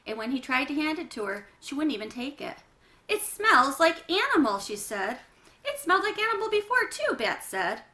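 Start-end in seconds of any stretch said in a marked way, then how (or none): 3.1-4.6 loud, high, grossed-out whine; disgusted complaint. Acted. Janie refusing her smelly pajama top, loud and disgusted. 5.6-7.1 quick earnest reply. Acted. Bat answering quickly.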